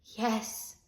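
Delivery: small, soft reply; cowed pupil's quiet yes; meek. A narrator's reading. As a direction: answer meekly, very softly. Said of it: "said Todd meekly".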